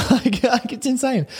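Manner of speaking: very high, laughing disbelief; amazed laugh. Real, not acted. Host telling listeners about guests, amazed and laughing. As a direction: marvel at how insane it is.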